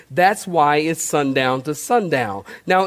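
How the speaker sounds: normal talking voice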